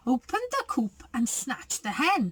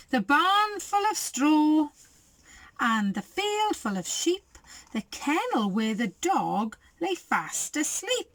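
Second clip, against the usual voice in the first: lively storybook reading; reading to children; 4 semitones higher than usual. A narrator's reading. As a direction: read it like a bedtime picture book. Reads picture book aloud.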